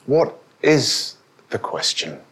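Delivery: slow, spaced-out "What is the question"; deliberate question. Real, not acted. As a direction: pause after "What" and after "is". Preacher repeats the question, word by word.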